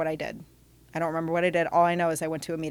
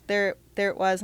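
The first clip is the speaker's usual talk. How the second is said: ordinary talk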